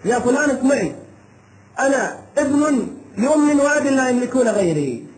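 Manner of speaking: normal talking voice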